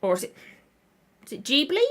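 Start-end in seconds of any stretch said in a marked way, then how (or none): 1.4-1.8 "Ghibli" as a rising JEE-blee question. Shown as a demonstration. Vlogger wondering which is correct, Ghibli as questioning JEE-blee. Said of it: "say it multiple different ways".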